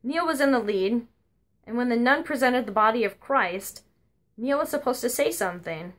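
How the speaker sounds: ordinary talk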